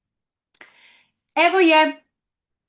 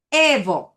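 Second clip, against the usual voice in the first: Evo with the E pushed, EH-vo; stress on first syllable; 2 semitones lower, 5 dB louder than usual. Shown as a demonstration. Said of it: "Stress the first syllable".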